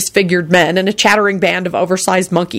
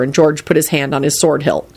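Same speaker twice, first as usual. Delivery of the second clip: plain reading voice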